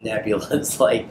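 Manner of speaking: ordinary talk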